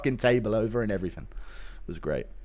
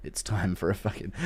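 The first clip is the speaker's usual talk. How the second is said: usual speaking voice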